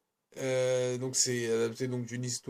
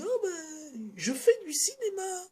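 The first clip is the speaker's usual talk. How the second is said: very high, airy put-on voice; mock pompous director; slower than usual. Vlogger mocks Tsui Hark bragging about making cinema, very high and airy. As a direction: send up a self-important filmmaker. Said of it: "il fait".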